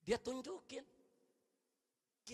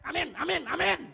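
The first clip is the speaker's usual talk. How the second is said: louder hasty amens, mocking the impatient; mock hurried yell; 4 semitones higher, 13 dB louder than usual. Imitating a young man at the back rushing the prayer, mock yell. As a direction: rattle off amins loudly to get it over with. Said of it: "di belakang dia teriak".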